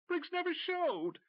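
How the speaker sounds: almost lose control, voice cracking high; nearly hysterical. Acted. McCracken repeating to Tim, nearly hysterical. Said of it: "his voice nearly hysterical".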